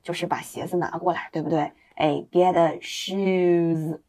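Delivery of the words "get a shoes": drawn-out teaching phrase; very slow phrase. Shown as a demonstration. Teacher slowly models the phrase for students. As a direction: stretch every word out. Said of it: "买鞋叫什么呢".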